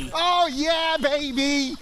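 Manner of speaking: high, loud whoop. Real, not acted. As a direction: cheer through the cold. In icy pool, whooping.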